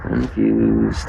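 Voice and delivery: drawn-out CONFUSED dropped very low; low-pitched confused. Pretend. Puzzled streamer to his viewers, deep drawn-out voice. Same word repeated very low.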